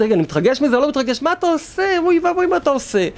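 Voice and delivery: high-pitched mock alarm at himself; mock dismay. Teacher scolding one who checks his own excitement, mock dismay. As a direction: pitch leaps up in exasperated disbelief.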